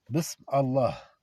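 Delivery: Bism Allah spelled out slowly, Allah clear; slow careful Bism Allah, every sound apart; slow demo. Shown as a demonstration. Teacher reads Bism Allah slowly to students. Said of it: "Ou alors vous dites Allah".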